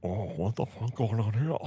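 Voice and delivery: joking about the anime cover in a Scottish Connery voice; Sean Connery impression. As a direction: play an affronted Sean Connery. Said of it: "has Sean Connery as the dragon in the corner going".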